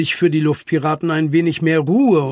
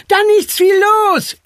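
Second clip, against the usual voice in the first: high, loud, eager cry; urgent shout; 7 dB louder than usual. A narrator's reading. Franka wants to set off at once, shouting. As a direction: call out loudly, pitch way up. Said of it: "rief Franka".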